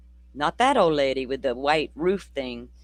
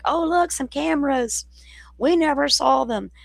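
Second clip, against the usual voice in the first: high, sing-song fake innocence; mocking innocence; 10 semitones higher, 3 dB louder than usual. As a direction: play dumb in a mocking high voice. Mocking neighbours who deny seeing.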